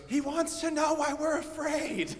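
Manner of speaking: high squeaky mock-outraged voice; exasperated John. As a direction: relay it in high comic disbelief. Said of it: "And John goes".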